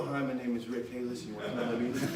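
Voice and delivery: low, quiet, fast mumbled pitch; mock a lifeless phone invitation; unexcited caller. Trainer shows how not to invite, low unexcited mumble. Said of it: "You gotta be excited".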